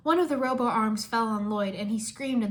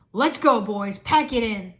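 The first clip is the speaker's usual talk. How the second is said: loud barked order to his men; 8 dB louder, slower than usual. Acted. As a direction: call the men out loudly. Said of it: "the commissioner ordered".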